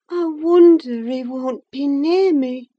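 ordinary talk

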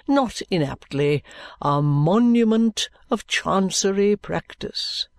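slow, drawn-out oratory, swelling on key words; pompous lawyer. Acted. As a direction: hold forth grandly and very slowly. Lawyer Kenge holding forth.

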